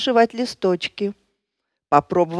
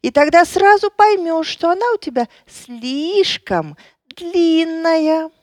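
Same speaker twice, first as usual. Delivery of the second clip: squeaky ant voice; 7 semitones higher than usual. A narrator's reading. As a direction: squeak like a tiny know-it-all. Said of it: "пропищал муравей".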